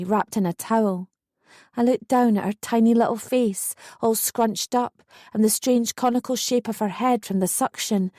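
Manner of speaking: ordinary delivery, nothing marked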